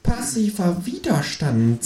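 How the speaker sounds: slow, high, pondering kangaroo voice; deliberate kangaroo. A narrator's reading. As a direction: weigh each word like a sly kangaroo. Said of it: "sagt das Känguru bedächtig".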